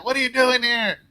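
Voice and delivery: hammed-up annoyed husband; mock angry. Husband snapping at his wife in the hospital, mock angry. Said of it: "he's all mad".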